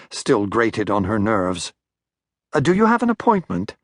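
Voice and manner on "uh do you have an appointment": high, quick flustered receptionist voice; a startled secretary rushing the question. A narrator's reading. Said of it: "she asked".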